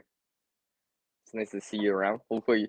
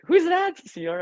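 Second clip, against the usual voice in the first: curious viewer voice; 4 dB louder than usual. Pretend. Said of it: "they'll be like".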